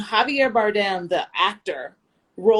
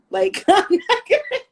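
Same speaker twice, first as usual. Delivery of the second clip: high, slow, laughing through the words; can barely get it out for laughing; 11 semitones higher, much slower than usual. Real, not acted. Joking to her cohost about trouble abroad, laughing.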